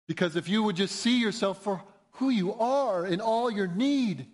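ordinary talk, nothing marked

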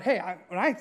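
ordinary talk, nothing marked

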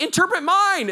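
high, loud mock clamour; eager demand. Korean crowd grabbing the visitor, eager high demand. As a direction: clamour excitedly for a turn.

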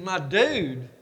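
mock-incredulous dad, rising on DUDE; questioning echo. Dad echoing his child's nickname for him, incredulous. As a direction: echo it back in mild disbelief. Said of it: "I said".

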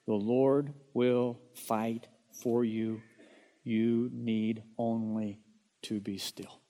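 very slow, weighty scripture reading with long pauses; slow solemn reading. Shown as a demonstration. Pastor reads Exodus fourteen fourteen to the congregation, slow and solemn. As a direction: lean on each word slowly, like a promise. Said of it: "Mark it down".

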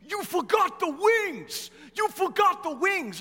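high, slow yell of an upset best man; Vito yelling. Retold or imitated. Best man yelling at the pastor across the aisle, high and urgent. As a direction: shout it up high like a big guy panicking. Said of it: "He's like".

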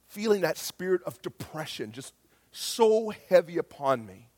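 ordinary delivery

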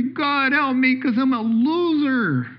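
high, mock pleading cry; hammed-up childlike cry for help; crying out like a kid. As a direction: plead like a helpless little kid. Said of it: "cry out like a little kid".

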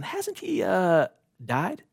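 put-on high, unsure boy's question; startled boy. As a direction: ask it half afraid of the answer. Eustace asks Aslan, startled.

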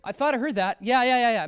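a hint of a cheerful couple at home; mock homeowners. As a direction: play the eager homeowners for a laugh. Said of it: "they were probably, like".

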